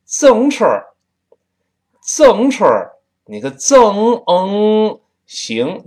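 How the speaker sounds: 天津话; Tianjin accent. Shown as a demonstration. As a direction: play up the Tianjin way. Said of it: "他说自行车儿".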